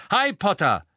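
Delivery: high, loud shout across the hall; loud angry call. A narrator's reading. Angelina calling Harry at the hall entrance, angry shout. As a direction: call him out sharply. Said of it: "rief eine laute und zornige Stimme".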